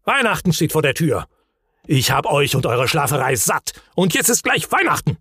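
fed-up Hemulen scolding, restrained in volume; angry outburst. A narrator's reading. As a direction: scold the sleepers, climbing high at the end. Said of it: "schrie erbost".

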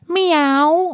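very high meowing cat. Retold or imitated. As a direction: squeak out a high meow. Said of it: "die ganzen Tiere alle durch".

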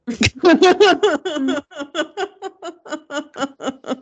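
high burst of laughter; loud laugh. Real, not acted. Laughs at own joke.

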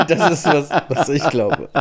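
said through laughter; laughing. Real, not acted. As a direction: laugh while repeating it. Repeats answer while laughing.

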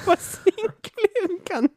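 high, slow giggling words; laughing. Real, not acted. Laughing at own silly story.

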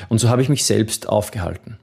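usual speaking voice

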